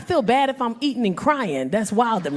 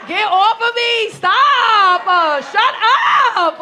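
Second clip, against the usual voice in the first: loud, higher laughing shout; mock shout; 7 semitones higher, 8 dB louder, much slower than usual. Comic shouting at flattering crowd, loud laughing shout.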